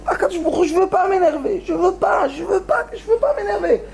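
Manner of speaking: high, pressed repetition; straining, high-pitched pleading; anguished plea. Retold or imitated. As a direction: fight to hold back anger. Film character pleading.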